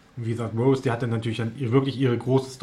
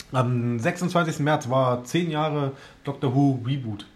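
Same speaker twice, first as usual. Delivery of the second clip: normal talking voice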